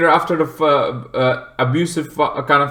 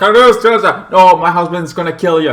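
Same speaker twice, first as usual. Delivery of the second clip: higher, louder mock plea to Rosa; panicked plea; 4 semitones higher, 5 dB louder, faster than usual. Retelling the movie, wife begs Rosa in mock panic. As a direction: play the frightened wife begging. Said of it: "It's like".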